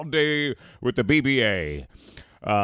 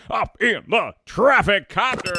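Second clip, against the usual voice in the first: radio host; 9 semitones higher, 6 dB louder than usual. Acted. Live show anchor toss.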